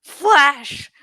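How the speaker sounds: usual speaking voice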